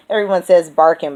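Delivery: ordinary talk, nothing marked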